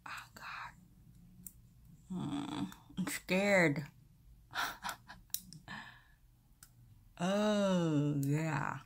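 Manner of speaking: speaking while fitting aligners; fingers in mouth. Real, not acted. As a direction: hands pressing on teeth. Seen 1: Both hands press clear aligners onto her teeth throughout the labelled words.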